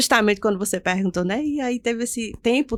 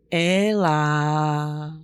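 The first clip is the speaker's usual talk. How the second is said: voce bassa tenuta a lungo; voce misteriosa; 5 semitones lower than usual. Retold or imitated. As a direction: imita una voce dal nulla, grave e prolungata. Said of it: "E novamente aquela voz".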